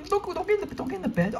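high, urgent plea, easing down; alarmed squeal. Real, not acted. Player warning against getting in the bed, alarmed squeal. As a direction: squeal the warning in a sudden fright. Alarm as danger arrives in game.

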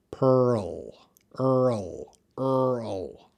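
PEARL and EARL stretched, R gliding into L; "pearl", "earl" elongated with a full R; drawn-out R-L demo. Shown as a demonstration. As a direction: slowly draw out the sounds of "pearl" and "earl". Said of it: "it's a physical, uh, physical skill".

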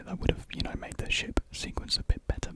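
ordinary delivery

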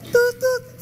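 high mouthed tut-tut beeps; imitating phone beep. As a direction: make a hold tone with your mouth.

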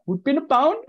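high, loud mock Indian accent. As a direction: squeal it in a hammed-up Indian accent. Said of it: "with, like, an Indian accent".

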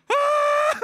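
sudden shrill-high loud yelp of shock; frozen in shock, a piercing high cry; shocked high scream. Retold or imitated. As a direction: let out a startled high scream as she falls. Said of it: "I'm like".

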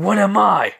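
high, loud acted demand; shouted challenge. Izuku catches the sword and challenges Ogre, shouting. Hero's taunting challenge to the villain.

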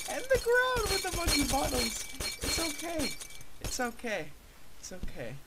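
very high, whining cry; high strained self-soothing. Real, not acted. Gamer reassuring himself after failing, high strained whine. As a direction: pitch it way up, then let it sink.

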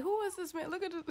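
high voice breaking into laughter; baby asking about the stranger, high and laughing; laughing quote. Acted. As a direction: laugh through the baby's confused question. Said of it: "He's like".

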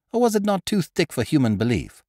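plain reading voice

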